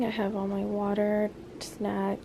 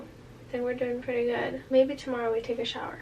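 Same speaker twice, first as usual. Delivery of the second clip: normal talking voice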